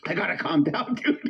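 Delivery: ordinary talk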